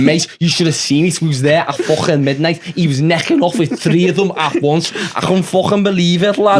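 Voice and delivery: thick put-on Scouse lad, chatty; Scouse accent. Lad telling his pal about last week, in a put-on Scouse accent. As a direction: play a Liverpool lad gossiping about his mate. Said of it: "sing in the Scouse accent".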